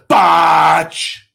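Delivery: high, loud shout of Botch. Shown as a demonstration. Shouting Botch after messing up live on TV. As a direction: yell Botch like you just messed up. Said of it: "Or just go".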